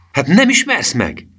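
high, slow incredulous question; incredulous exclamation. A narrator's reading. Disguised Gustav asking to be recognised, incredulous. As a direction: draw out the question at a high pitch.

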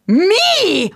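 high, loud yelp of disbelief; shocked shout. Acted. Daughter yelling at mother's news. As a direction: blurt it out in shock. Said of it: "ordítottam".

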